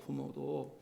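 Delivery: ordinary talk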